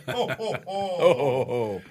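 slow, soft Santa ho ho ho; a light Santa chuckle; Santa laugh. Retold or imitated. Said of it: "I look like Santa".

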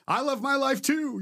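high, far-pitched imitation of a teenager; eager kid. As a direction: chime in like an overeager kid. Retold kid joins in calling out.

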